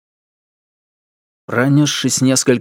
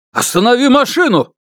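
shouting; 12 semitones higher, 4 dB louder than usual. Acted. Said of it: "истерично закричал".

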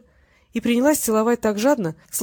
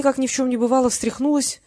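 ordinary delivery

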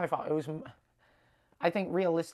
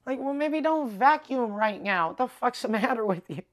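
exasperated rant; 6 semitones higher, 5 dB louder than usual. Real, not acted. Ranting at cleaners vacuuming.

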